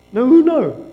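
ordinary talk

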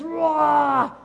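loud high angry roar. Shown as a demonstration. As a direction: let out a big roar. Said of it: "You make a noise".

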